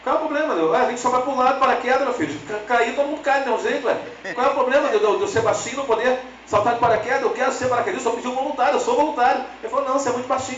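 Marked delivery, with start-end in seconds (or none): 0.0-9.4 indignant protest. Retold or imitated. Protesting to the sergeant. 9.8-10.6 high mock sergeant. Sergeant refuses again.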